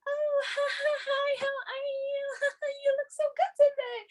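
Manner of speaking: high, giddy imitation of a friend; imitating Kim. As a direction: gush like an overexcited friend. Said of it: "you know how Kim is".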